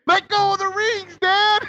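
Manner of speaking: put-on high, slow child's yell; imitating a son screaming to his dad; kid yelling. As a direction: shout it high like a kid on the boat. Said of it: "you hear the kid".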